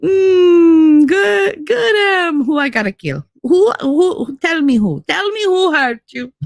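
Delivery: high, loud hammed-up threat, asking who to kill; a pretend knife-wielding avenger, played big and high; mock vengeful Ziti. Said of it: "I'm picturing Ziti with, like, the kitchen knife".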